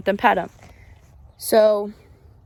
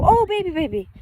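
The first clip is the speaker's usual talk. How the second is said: much higher cooing pet voice; 10 semitones higher than usual. Real, not acted. Cooing at cow, drops brush.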